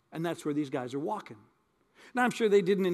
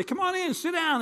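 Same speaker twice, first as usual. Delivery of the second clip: welcoming host; 10 semitones higher than usual. Retold or imitated. Host welcoming guests at door.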